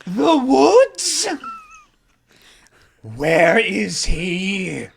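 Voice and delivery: high-pitched outraged cry, slowing right down; indignant yell. Acted. Vampire hears of camping in the bar, indignant yell. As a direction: cry out high, then draw it out. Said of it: "And then they just yell".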